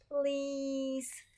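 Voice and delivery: high, drawn-out beg; pleading. Acted. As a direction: stretch it out high, begging your sister. Child begs sister in story.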